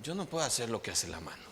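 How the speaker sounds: quiet, plain statement of the foot; a soft, simple complaint; simply said. Retold or imitated. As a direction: say it softly, matter of fact. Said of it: "el pie dice simplemente".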